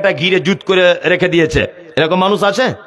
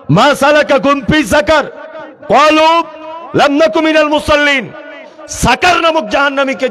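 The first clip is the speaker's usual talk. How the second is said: full-force preaching; 7 semitones higher than usual. Acted. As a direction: proclaim it to the whole gathering.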